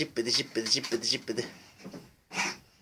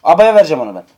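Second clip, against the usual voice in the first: loud mock claim; 3 semitones higher, 7 dB louder than usual. Loud childish claim over cradle.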